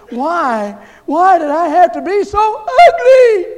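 high, loud, slow, sobbing wail; crying girl. Retold or imitated. Young girl sobbing to the youth director, high and loud. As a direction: wail it through tears. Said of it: "She says".

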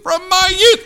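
the peak of the sermon; climactic preaching shout. Real, not acted. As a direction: push youth to the top of the voice. Repeats phrase at peak.

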